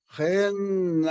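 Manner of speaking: Henna with the vowel held long; drawn-out Henna. Retold or imitated. Elder stretching Henna long in her story. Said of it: "That's how long she had that word".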